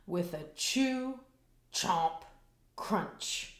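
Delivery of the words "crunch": ordinary delivery, nothing marked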